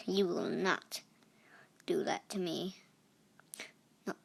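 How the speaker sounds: breathy unvoiced threat; hushed pretend warning to the other snake; mock menacing whisper. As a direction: whisper it like a playful villain.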